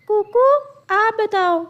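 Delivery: high, bright-pitched teacher bird voice; a high squawky parrot teacher calling on a student. Acted.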